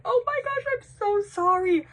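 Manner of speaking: very high squeaky wail; high-pitched pleading, acted out; retold mortified apology.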